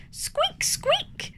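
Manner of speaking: high whispered squeak; little rabbit squeaking; tiny rabbit squeak. Acted. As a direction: squeak it breathily like a baby bunny. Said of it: "says Richard".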